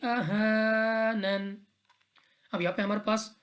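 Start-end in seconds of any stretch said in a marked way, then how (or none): 0.0-1.6 Quran recitation. Shown as a demonstration. Tajweed teacher recites the verse for students, slow melodic chant. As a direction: stretch every vowel in a long, sung recitation.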